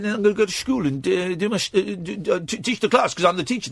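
high-pitched mock Dutch stoner accent; hammed-up chompy Dutch accent; crazy Dutch accent. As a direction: ramble as a Dutch caricature, chewing words in the back teeth. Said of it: "I'm a crazy Dutch guy".

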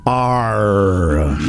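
low, drawn-out pirate growl; pirate arr. Acted. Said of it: "not doing it in a pirate".

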